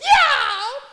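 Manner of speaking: very loud, high cat screech; cat's pained yowl. Acted. Cat loses its paw to Jack's knife, screeching yowl. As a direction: yelp like a wounded cat.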